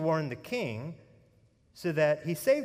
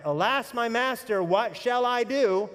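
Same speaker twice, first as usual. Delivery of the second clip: louder, higher, slow "what shall I do?" as a cry for help; pleading cry, higher and louder, drawn out; 6 semitones higher, 4 dB louder than usual. Retold or imitated. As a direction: cry out "Alas, my master, what shall I do?" in alarm, slowly. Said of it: "all you could do is cry out".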